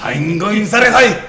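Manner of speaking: high, loud, slow anime hero shout; overacted anime dub. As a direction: belt it like an anime battle cry. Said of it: "You sound like an anime character".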